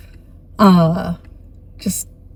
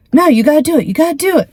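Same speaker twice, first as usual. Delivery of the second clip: high eager pleading; 8 semitones higher, much faster than usual. Retold or imitated. Tax guys urging boss.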